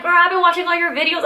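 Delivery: fast, high excited quote; gushing friend. Retold or imitated. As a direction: gush like a fan. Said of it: "she's like".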